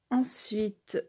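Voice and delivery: ordinary delivery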